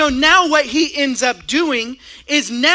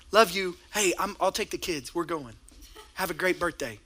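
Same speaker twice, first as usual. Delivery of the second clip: softer, tossed-off farewell on the way out; quiet offhand goodbye; 8 semitones lower, 10 dB quieter than usual. Retold or imitated. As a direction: mumble it casually so she barely hears. Husband's casual goodbye to sleeping wife.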